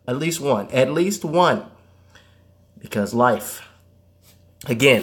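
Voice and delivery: ordinary talk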